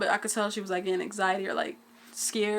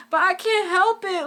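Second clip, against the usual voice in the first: high, slow tearful plea; mock crying mom; 10 semitones higher, 6 dB louder than usual. Mom who can't stop crying, mimicked high and slow. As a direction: imitate mom fighting back tears. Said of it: "she was like".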